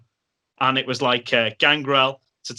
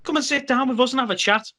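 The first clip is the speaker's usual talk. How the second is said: much higher, put-on voice of a welcoming wrestler; friendly invite; 12 semitones higher than usual. Gangrel inviting the narrator to sit and chat, high put-on voice. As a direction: sing it out like a big friendly host. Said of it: "And he said".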